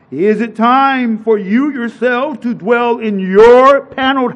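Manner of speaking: measured, ringing preacher's reading; preacher reading Haggai aloud, measured and ringing; scripture reading. Acted.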